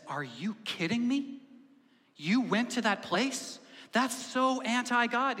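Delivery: mock-appalled, slowing and rising in pitch; incredulous outrage. Scandalized group member confronts George, incredulous outrage. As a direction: act scandalized by a friend's confession.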